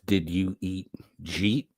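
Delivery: "jeet" with a rising question tune; Georgia drawl. Shown as a demonstration. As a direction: ask "Did you eat jeet?" southern style. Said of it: "it literally means".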